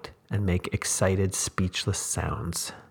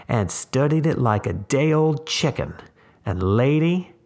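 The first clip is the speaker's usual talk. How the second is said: raised, intense drifter's voice; 6 semitones higher, 4 dB louder than usual. A narrator's reading. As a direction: press it on her like a preacher. Tramp voiced in reading.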